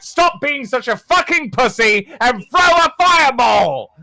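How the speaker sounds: high, loud impatient yell; Orion yelling back. Acted. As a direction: bellow an order at a cowardly partner. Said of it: "hear [name] just yell back".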